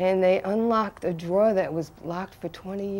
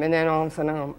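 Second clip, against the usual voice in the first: ordinary delivery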